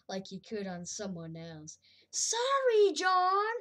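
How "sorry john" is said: loud, high, singsong sorry; mock apology. Real, not acted. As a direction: call out a fake apology.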